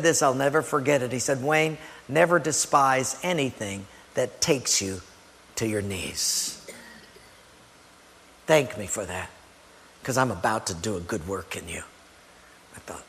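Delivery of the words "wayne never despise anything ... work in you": low, hushed, weighty retold advice; solemn counsel. Mentor counselling the preacher on hardship, solemn and hushed. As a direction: quote a mentor gravely, softly and low.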